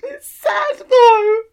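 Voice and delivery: very high, slow, hammed-up crying whine; mock sob. Defending own tears to the co-star, a mock sob. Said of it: "And I was like".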